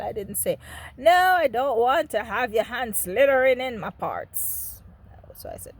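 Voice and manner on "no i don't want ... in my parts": loud, raised mock outburst; imagined outburst. What the decent child didn't say to father, loud outburst. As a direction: cry out the refusal you never dared voice.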